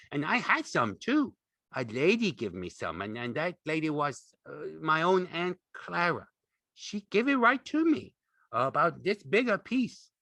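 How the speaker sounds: put-on high, eager, childlike chatter; happy excitement. A narrator's reading. Lenny recalls Aunt Clara's velvet, happy and excited. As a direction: brag happily, voice leaping up. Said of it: "he cried happily".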